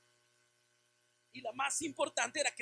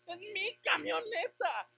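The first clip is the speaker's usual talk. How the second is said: disbelief; 7 semitones higher, 5 dB louder, slower than usual. Retold or imitated. Motorbike falls on his truck.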